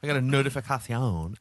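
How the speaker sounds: Spanish-accented notificación, th-lisped c; notificación said the Spanish way, notifikathion. Shown as a demonstration. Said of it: "that was a Spanish accent".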